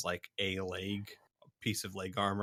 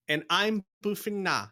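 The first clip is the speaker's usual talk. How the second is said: small female wolfen; 9 semitones higher, 4 dB louder than usual. Acted. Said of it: "the bigger wolfen and says".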